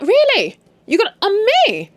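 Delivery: high, loud, incredulous challenge; mock outrage, voice shooting up; outraged disbelief. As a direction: act out shrieky indignation. Acting out her outraged disbelief.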